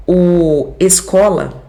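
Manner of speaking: o escola stretched slowly with an open O; local escola. Shown as a demonstration. Teacher shows the local way of saying escola. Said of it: "A gente fala o escola".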